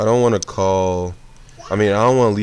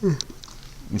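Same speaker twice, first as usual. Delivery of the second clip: said through a slow yawn; 8 semitones higher, 7 dB quieter than usual. Real, not acted. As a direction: stretch it out mid-yawn. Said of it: "I'm yawning".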